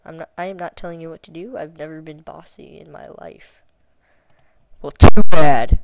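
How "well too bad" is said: shouted too bad; loud, dismissive comeback; loud retort. Real, not acted. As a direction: bark it back at the bot. Arguing with chatbot, typing aloud.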